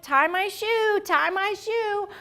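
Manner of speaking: put-on high, whiny-kid style plea; high-pitched mock helplessness; childlike pleading. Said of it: "that's a little bit like".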